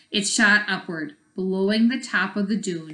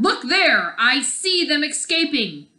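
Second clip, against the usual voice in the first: higher, louder sly shout; 8 semitones higher, 5 dB louder than usual. Acted. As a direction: trick them with a shout. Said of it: "the Nin leader shouted".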